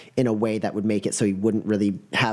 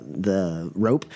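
usual speaking voice

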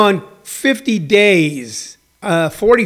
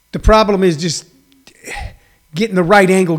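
normal talking voice

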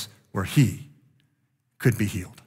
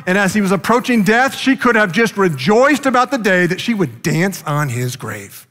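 higher and louder, building preacher's cry; mounting sermon climax, higher and louder; fiery preaching; 7 semitones higher, 5 dB louder than usual. Acted. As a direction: preach it at full force, rising higher and louder. Preaching builds to full force.

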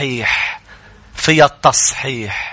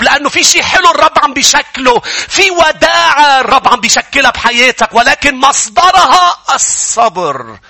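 high-pitched, driving sermon delivery; impassioned preaching; 8 semitones higher, 4 dB louder than usual. Real, not acted. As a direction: build higher and higher like a fired-up preacher. Preaching at full force.

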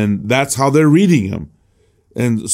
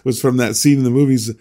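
usual speaking voice

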